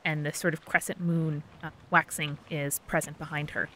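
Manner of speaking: ordinary talk, nothing marked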